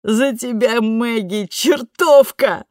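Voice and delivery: high, loud, slow drawn-out toast. A narrator's reading. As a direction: raise a glass and proclaim. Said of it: "провозгласила она".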